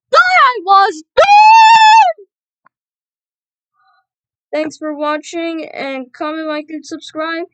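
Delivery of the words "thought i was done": exasperated yell; frustrated shout. Real, not acted. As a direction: shout it in disbelief. Frustrated after failing.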